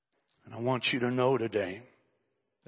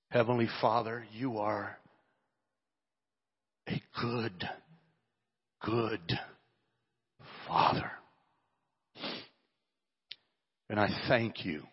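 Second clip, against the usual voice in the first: fervent prayer. Acted. Pastor praying over the church, fervent and building. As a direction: pour it out to God.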